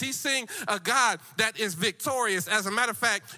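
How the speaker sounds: rousing church preacher in full flow; full-force preaching. Real, not acted. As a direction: preach victory to the congregation with punched key words.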